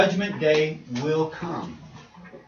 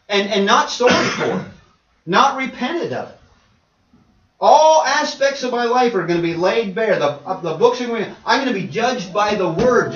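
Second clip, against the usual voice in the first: earnest retold plea; 4 semitones higher, 6 dB louder than usual. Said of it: "she was saying".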